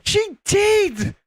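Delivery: high-pitched indignant protest; emphatic insistence. Real, not acted. Insisting against repeated contradiction.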